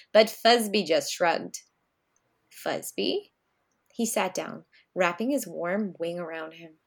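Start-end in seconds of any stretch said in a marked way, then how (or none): 2.5-3.3 gentle call. A narrator's reading. Pop calling softly to grandson.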